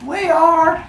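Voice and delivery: hammed-up cold villagers; eager reply. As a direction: shout it like freezing villagers. Humans answer eagerly.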